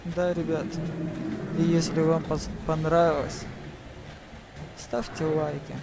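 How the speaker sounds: ordinary talk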